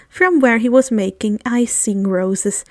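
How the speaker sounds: ordinary delivery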